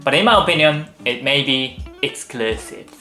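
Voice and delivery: ordinary delivery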